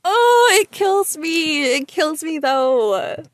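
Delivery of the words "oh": usual speaking voice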